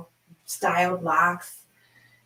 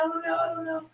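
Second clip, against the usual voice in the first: high, small, softer voice; retold doubt; 11 semitones higher, 5 dB quieter than usual. Retold doubtful reply to him.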